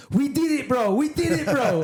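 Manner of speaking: high, excited mimic of a mate; high joyful mate voice; mock celebration. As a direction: cheer it like a shared win. Jeremy celebrating.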